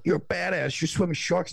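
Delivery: hammed-up admiring tourist; American impression. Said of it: "I love your American impression".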